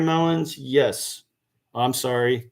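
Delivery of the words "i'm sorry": usual speaking voice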